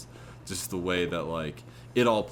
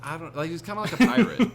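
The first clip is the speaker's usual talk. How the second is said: fast, high and louder, laughing through it; 10 semitones higher, 8 dB louder, faster than usual. Real, not acted. Co-host laughing while calling the actor's accent pirate-like, fast and high. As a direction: crack up while making the joke.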